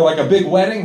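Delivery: ordinary talk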